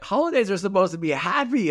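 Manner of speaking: high, incredulous protest; mock indignant disbelief. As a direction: act shocked that a holiday feels sad. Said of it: "I'm like".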